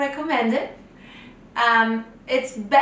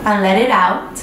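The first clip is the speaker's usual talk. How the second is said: unhurried breathing cues, louder than the chatty intro; guided meditation; 3 dB louder than usual. Acted. Guide to children lying in bed, guided meditation voice. Leads breathing before bedtime story.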